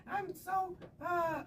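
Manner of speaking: high-pitched mocking girl voice; mock bragging girl. Mocking girls listing their accomplishments.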